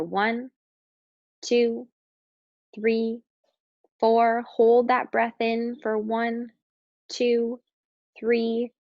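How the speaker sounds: evenly spaced, drawn-out counts with pauses between; slow breath count. Real, not acted. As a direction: pace each number for a long, steady breath. Counting aloud to pace breathing.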